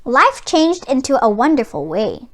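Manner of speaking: ordinary delivery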